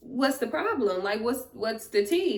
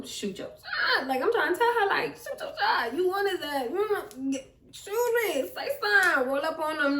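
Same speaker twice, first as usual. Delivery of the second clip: excited egging on; 8 semitones higher than usual. Retold or imitated. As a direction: urge a shy friend on, much higher. Hyping friend to approach brother.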